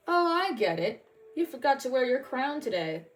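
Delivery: mocking soldier.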